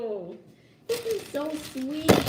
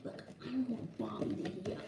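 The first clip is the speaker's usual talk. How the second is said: quiet; 2 semitones lower, 8 dB quieter than usual. Real, not acted. Hiding from Bobby, lowers voice.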